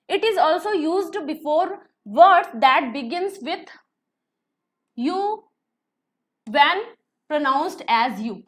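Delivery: each word of the rule read out slowly and deliberately; slow dictation reading. Acted. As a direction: read the definition as if students must copy it down. Reads grammar rule aloud slowly.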